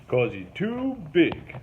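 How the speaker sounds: slow, heavy Link voice; lightly voiced swamp boatman, slow and emphatic; slow rural boatman leaning on too; Link's drawl. A narrator's reading. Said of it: "Link said".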